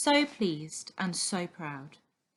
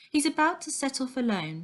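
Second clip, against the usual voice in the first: plain reading voice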